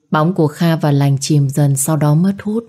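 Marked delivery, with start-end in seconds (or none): none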